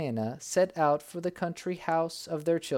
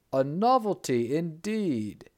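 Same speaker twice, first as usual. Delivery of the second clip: slow, louder amazed exclamation; cried out; 4 dB louder than usual. A narrator's reading. Sultan finding pearls in his cucumber, crying out amazed. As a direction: marvel at it aloud. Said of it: "cried he".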